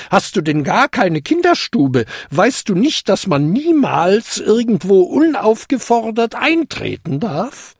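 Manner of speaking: high, indignant scolding. A narrator's reading. Old lady scolding the kobold who barged in, indignant. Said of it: "entrüstete sie sich".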